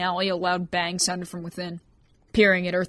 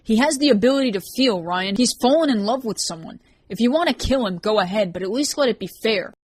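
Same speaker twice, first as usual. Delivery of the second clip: upset pleading; 4 semitones higher, 6 dB louder than usual. Acted. Rain argues back, upset.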